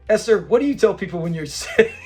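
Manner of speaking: Fred's line broken by laughter; can't get through the quote without laughing. Retold or imitated. Host quoting Fred to Esther, cracking up. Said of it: "you have to laugh".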